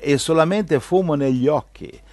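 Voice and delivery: preaching. Acted. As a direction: proclaim it from the pulpit. Preaching at full force. (predica enfatica)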